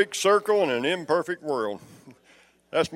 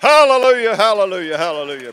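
shouted hallelujah; 5 semitones higher than usual. Real, not acted. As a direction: shout hallelujah, then let it fall.

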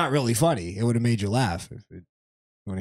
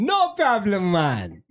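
hammed-up Jamaican accent, higher on no problem; mock Jamaican accent; 7 semitones higher, much slower than usual. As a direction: toss it off in a playful fake Jamaican lilt. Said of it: "trying to do an impression of her mother".

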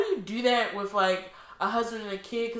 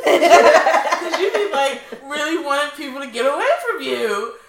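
laughing; 5 semitones higher, 11 dB louder than usual. Real, not acted. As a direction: laugh the words out, much louder. Laughing at herself.

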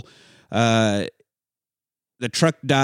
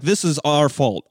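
ordinary delivery

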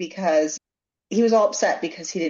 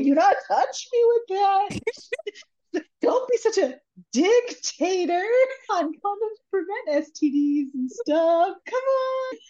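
mock teasing; 11 semitones higher than usual. Acted quarrel over dropped condom.

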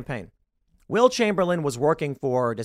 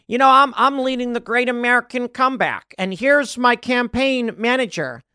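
mock DeSantis rally; 8 semitones higher, 5 dB louder than usual. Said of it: "they're like".

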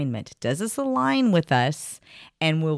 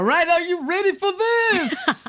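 high, slow, teasing build-up; playful teasing; 11 semitones higher than usual. Real, not acted. Host teasing the guest before the final fun question, playful.

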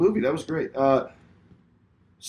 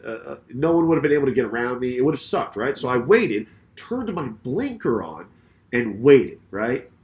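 usual speaking voice

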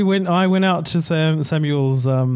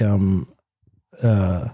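congested, stuffy blocked-nose speech; 6 semitones lower, much slower than usual. Real, not acted. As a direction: talk through a blocked nose, slowly.

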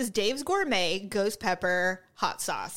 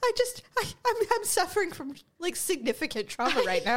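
a much higher, hammed-up suffering voice; mock traumatized friend; 6 semitones higher, faster than usual. Teasing her co-host about her face, much higher, hammed-up suffering. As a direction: overplay the suffering. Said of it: "Your face was like".

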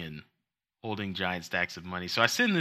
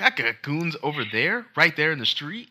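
mock kid gangster; 5 semitones higher, 5 dB louder than usual. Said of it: "she was like".

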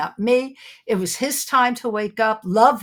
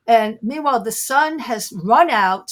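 usual speaking voice

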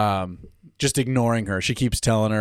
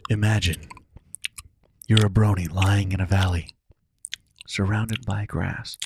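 mock ASMR whisper; 4 semitones lower than usual. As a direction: lull the listener like a joke meditation. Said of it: "going all full A S M R here".

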